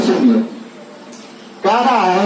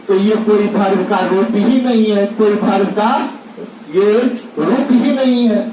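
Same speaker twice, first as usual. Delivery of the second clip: usual speaking voice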